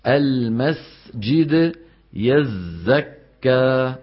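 "المسجد يزكى" very slow, س and ز held long; clear sibilants. Shown as a demonstration. Teacher showing clear whistling letters, "المسجد يزكى" slow with long sibilants. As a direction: draw out each whistling sound in "المسجد يزكى". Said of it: "لابد من البيان".

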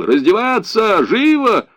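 high, loud barked command; shouted order. A narrator's reading. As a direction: shout it like a soldier hurrying prisoners. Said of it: "Другой немец кричал". (крик-приказ, высоко и громко)

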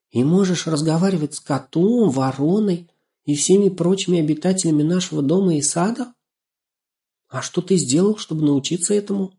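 put-on high, curious girl's voice; amazed little girl. A narrator's reading. Girl asking how he talks to animals, amazed. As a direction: ask in wonder.

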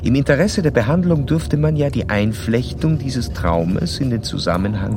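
measured narrator reading a clinical report; formal case narration. Acted. Doctor narrating his case study to the listener in a formal reading voice. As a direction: recount the case like reading from your own notes.

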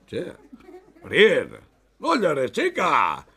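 high, loud mock condolence; hammed-up mourner. Mourner consoling the widow Perín, high and loud. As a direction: console the widow over the top.

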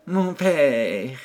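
bashful protest. A narrator's reading. Modest daughter, eyes lowered.

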